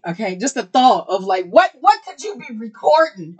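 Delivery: high, loud fan squeal; excited squeal. Real, not acted. Fangirling over new music.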